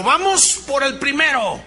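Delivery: high, loud hype-man call; rallying coach. Retold or imitated. Motivator firing up store employees, loud. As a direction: pump up the room like a sales coach.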